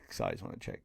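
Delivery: quick hushed apology; whispered aside. Real, not acted. Whispering an apology to viewers while checking. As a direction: drop to a whisper to excuse yourself.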